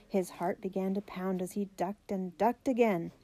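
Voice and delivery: ordinary delivery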